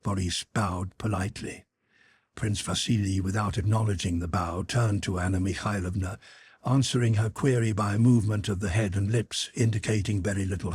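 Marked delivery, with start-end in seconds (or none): none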